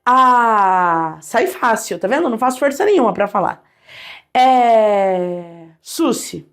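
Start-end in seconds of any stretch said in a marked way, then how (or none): 0.0-1.2 a long, easy open "A."; held vowel. Shown as a demonstration. Teacher demonstrating vowels for students to repeat. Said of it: "vai repetindo comigo". 4.3-5.7 a long, easy open "A."; held vowel. Shown as a demonstration. Teacher demonstrating vowels for students to repeat. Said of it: "vai repetindo comigo".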